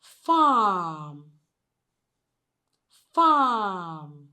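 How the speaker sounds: "farm" with a drawn-out "ah", no r; long vowel. Shown as a demonstration. Teacher reads second example word, long vowel. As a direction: stretch the middle of "farm". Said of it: "this particular sound, sound occurs in words like".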